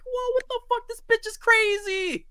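squeaky, high, stretched-out disbelief; high mock shock. Friend recapping an anime scene, high mock shock. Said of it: "I'm like".

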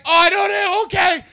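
high, loud startled agreement; flustered yelp. Retold or imitated. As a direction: caught off guard, give in loudly. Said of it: "And I was like".

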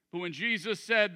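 ordinary talk

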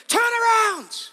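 high, drawn-out urgent shout; slow, full-voiced preacher's yell. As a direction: cut in with a loud cry. Preacher shouts over an objection.

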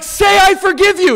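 high, loud shouted command; insistent, worked-up dad yell; urgent demand. Retold or imitated. Dad berating his gasping son, urgent shout.

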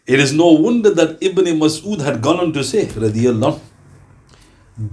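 ordinary talk

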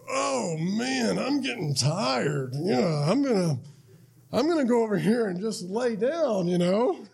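Jesus feigning weariness with a knowing smirk; smirking fake tiredness. Jesus to his disciples, pretending to be sleepy, smirking. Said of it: "doing it with a smirk".

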